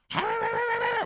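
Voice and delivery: high-pitched mock dog snarling; imitated dog attack. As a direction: imitate a dog going for someone. Imitates dog going after gardener.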